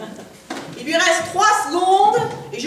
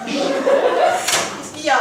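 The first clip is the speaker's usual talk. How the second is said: feigned innocence; 8 semitones higher, much slower than usual. Acted.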